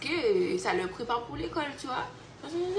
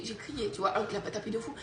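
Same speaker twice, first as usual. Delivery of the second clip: ordinary talk, nothing marked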